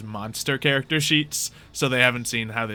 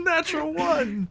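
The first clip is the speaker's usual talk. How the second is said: high, slow, drawn-out call; excited announcement; slower than usual. Real, not acted. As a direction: announce it with glee. GM announcing the failed roll.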